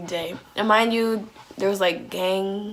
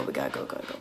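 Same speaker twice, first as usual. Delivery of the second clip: hushed, urgent whisper to a friend; 13 dB quieter, much faster than usual. Retold or imitated. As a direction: breathe it out so nobody hears. Urging friend to leave secretly.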